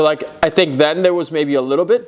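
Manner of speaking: ordinary talk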